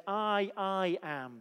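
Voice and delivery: "I, I am" slow, doubling the I; emphatic I am. Real, not acted. Preacher showing the Greek ego eimi, slow and doubled. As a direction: slowly repeat the I in "I, I am". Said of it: "When Jesus says the "I am" sayings".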